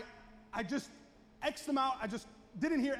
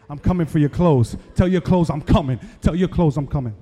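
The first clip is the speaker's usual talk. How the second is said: low, loud mock brother voice; imitating confused younger brother; 5 semitones lower, 18 dB louder than usual. Preacher mimicking his confused younger brother to the crowd, low loud impression. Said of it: "he does one of these, like".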